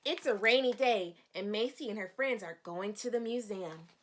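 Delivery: put-on bright read-aloud voice for children; storybook reading. Teacher reading the storybook to her young students. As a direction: read the page like story time.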